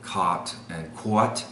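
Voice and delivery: New York "cot" then "quat", second rounded; New York accent, "caught" as "kwawt"; New York vowels. Shown as a demonstration. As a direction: split the vowels like a New Yorker. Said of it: "So in New York".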